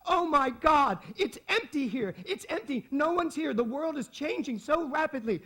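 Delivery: high, loud panicked shriek into a phone; mock a frantic shopper, high and loud; imitating a screaming woman. Woman on her cell phone screams to her friend about the empty mall, high and loud. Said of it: "she's screaming at her friend".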